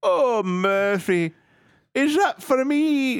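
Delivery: high, slow delighted wife; mock swooning wife. Wife thrilled by the ring, high and slow. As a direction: coo it up high.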